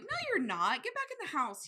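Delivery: acted exasperated telling-off; imitated scolding. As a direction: order mom back, pitch jumping up. Typical scolding reaction voiced.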